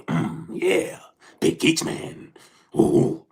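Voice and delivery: low breathy voicing of the man's pleasure; mock pleased groan. Voicing the man in the video, a low mock pleased murmur. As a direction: whisper it low like he enjoyed it. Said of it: "He instantly".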